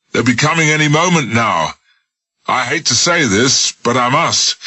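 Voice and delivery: high, pressing voice of menace; grim warning. Acted. Smith frightens Jones into sliding down with grim threats. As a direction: scare him with dire warnings.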